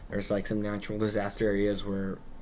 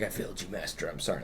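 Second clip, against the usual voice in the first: softer, lower, fading last words; dying apology; 6 semitones lower, 10 dB quieter, faster than usual. Retold or imitated. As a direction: let the voice sink away as it dies. Defeated tree's dying last words.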